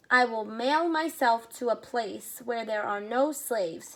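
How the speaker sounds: ordinary delivery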